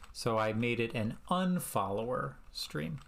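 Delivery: normal talking voice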